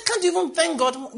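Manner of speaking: high, loud rebuke; exasperated challenge. Retold or imitated. Preacher scolding a complaining chat group, loud and high. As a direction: throw the question at them in disbelief. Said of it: "I said".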